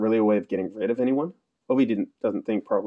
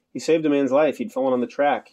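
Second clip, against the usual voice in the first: higher, louder call; hailing; 5 semitones higher, 5 dB louder than usual. A narrator's reading. Locked-in journalist calls to Parvana, hailing loudly. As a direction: call it out through a closed door. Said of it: "hailed the garage door".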